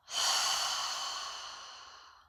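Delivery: long, loud breathy sigh out; big audible sigh of release; demonstrated exhale. Shown as a demonstration. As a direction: breathe out fully through an open mouth. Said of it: "out through the mouth".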